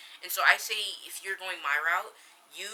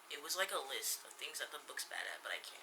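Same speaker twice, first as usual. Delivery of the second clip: whisper; 4 semitones lower, 15 dB quieter than usual. Real, not acted. Trailing off while unsure.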